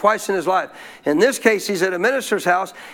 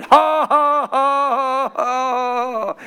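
high, drawn-out mock laugh, easing in volume; put-on defiant laugh; 5 semitones higher, much slower than usual. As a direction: laugh the devil off with forced ha-has. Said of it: "He started laughing".

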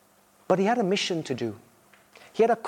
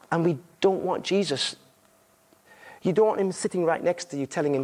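ordinary talk, nothing marked

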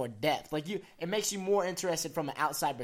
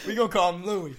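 loud, high, real laughter into the words; laughing; 4 semitones higher, 8 dB louder, faster than usual. Real, not acted. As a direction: crack up while deciding. Laughing about how to say Louis.

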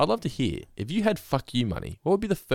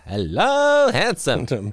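high crooning "hello handsome"; mock sleazy greeting, swooping up; imitating Artie; 7 semitones higher, 6 dB louder, much slower than usual. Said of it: "this is where we get the".

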